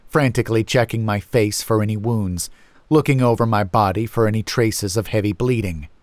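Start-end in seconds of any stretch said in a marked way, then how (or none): none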